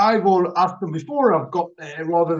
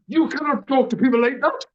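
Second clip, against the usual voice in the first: imitated telling-off, pitched up; scolding; 6 semitones higher than usual. Wife tells husband off in the car, scolding. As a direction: scold him in a higher voice. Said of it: "I get it in the ear".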